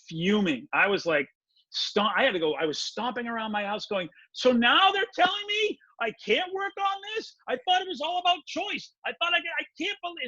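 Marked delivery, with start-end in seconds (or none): 4.3-10.2 high, frustrated yelling at herself; retold yelling. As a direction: rant high and shrill in disbelief. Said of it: "I am yelling and screaming at myself".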